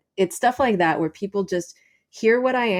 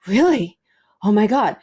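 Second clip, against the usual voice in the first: eager stranger; 3 semitones higher, 4 dB louder than usual. Retold or imitated. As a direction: imitate a man seizing on free advice. Voices eager stranger hearing her job.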